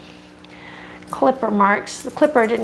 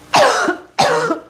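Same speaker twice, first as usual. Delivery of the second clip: choking cough; 13 semitones higher than usual. Real, not acted. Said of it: "I'm choking on something here".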